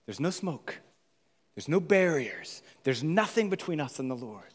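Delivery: ordinary talk, nothing marked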